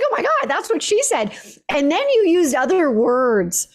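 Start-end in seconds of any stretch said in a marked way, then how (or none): 0.0-1.3 high-pitched, laughing disbelief; amazed reaction. Retold or imitated. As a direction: retell an astonished realization, pitched way up. Retelling her own astonished reaction.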